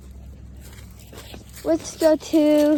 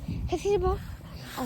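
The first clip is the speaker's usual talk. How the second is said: usual speaking voice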